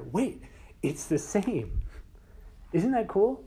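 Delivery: high, loud delight; bursting with wonder; real excitement. Real, not acted. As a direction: share the thrill with the room. Preacher marvels at the parallel.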